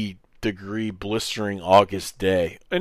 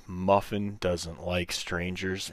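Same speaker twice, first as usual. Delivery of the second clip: slow, quieter disbelieving repeat; retold incredulous echo; 5 dB quieter, slower than usual. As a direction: imitate a buddy echoing it slowly, word by word. Buddy echoes the line in disbelief.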